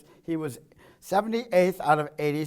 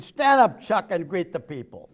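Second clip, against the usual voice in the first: mock loud command; 3 semitones higher, 7 dB louder than usual. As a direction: bark it out like a cheerful order. Quoting Biden to paralyzed senator.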